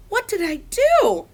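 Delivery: high, loud squeaky question; squeaked. A narrator's reading. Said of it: "I squeaked".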